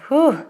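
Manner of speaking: high, winded whew out; breathless whew. Real, not acted. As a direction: blow out a tired whew. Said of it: "I'm panting".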